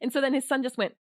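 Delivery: ordinary talk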